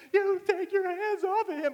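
high, loud imitation of a furious child; mock-furious kid shouting; mad little brother. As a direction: yell like a seven-year-old to the rescue. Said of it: "He is so mad".

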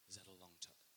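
small, low, soft voice; meek question. Retold or imitated. Worried swimmer checking with the barman, meek and quiet. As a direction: ask it under your breath. Timidly asks barman about sharks.

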